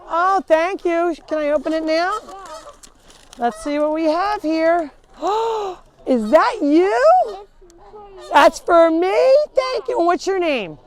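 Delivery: high, bright thrilled thanks; delighted opening a present. Real, not acted. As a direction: gush over a surprise gift.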